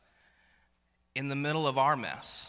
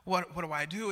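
put-on flustered child; 3 semitones higher, 6 dB quieter than usual. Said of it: "he was like".

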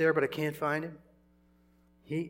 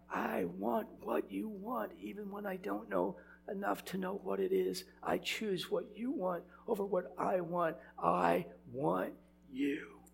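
tender prayer; 5 semitones higher, 7 dB quieter than usual. Retold or imitated. Voicing his own prayer to God.

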